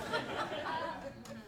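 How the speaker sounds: breathy high giggle; real high soft laugh. Real, not acted. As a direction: let a little high laugh slip. Laugh after Invisalign joke.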